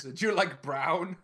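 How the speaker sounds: mocking. Echoing mock question.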